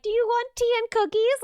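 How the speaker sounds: high, lightly imitated old lady's offer; sweet old neighbour. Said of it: "she would be like".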